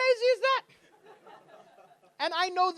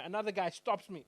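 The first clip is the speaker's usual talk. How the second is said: ordinary talk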